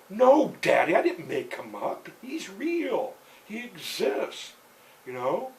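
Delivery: high, loud protest with a laugh; loud protesting no Daddy; indignant girl. Retold or imitated. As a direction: insist he's real to her father. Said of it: "daughter protesting".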